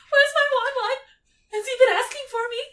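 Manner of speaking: put-on very high, loud squealing girlfriend voice; shrieking mock-sweet, a bit fast; clingy Lavender. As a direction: gush like a lovesick teen. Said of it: "she's like".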